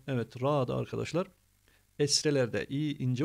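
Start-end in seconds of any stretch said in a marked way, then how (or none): none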